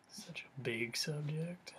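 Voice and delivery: breathy whisper, barely voiced. Real, not acted. Musing to the audience about meditation, whispered. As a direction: let the words fall to a whisper. Whispers aside on big topic.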